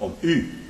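"u" said as a clean vowel, no w; correct u. Shown as a demonstration. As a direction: end "u" open, no w. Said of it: "die maken er een W achter".

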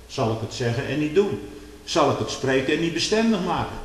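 loud, high, rising sermon fervour; fiery preaching. Acted. Preacher warning his congregation, preaching at full force. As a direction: thunder it from the pulpit.

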